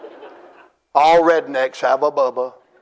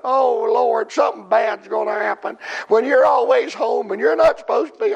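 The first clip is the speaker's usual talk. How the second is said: mock fretful wife; 8 semitones higher than usual. Wife's fearful reply retold.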